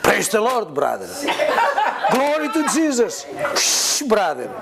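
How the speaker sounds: mock the churchy put-on piety; pious churchgoer. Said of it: "their countenance is changing".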